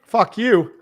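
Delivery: playful loud fuck you at the host; mock-angry loud jab at the host; joking loud retort. Real, not acted. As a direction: bark it back as a joke.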